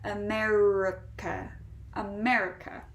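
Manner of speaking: America with the middle R held; American R. Shown as a demonstration. Teacher showing the R right in the middle, clear American R. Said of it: "perfect example for that American R right in the middle".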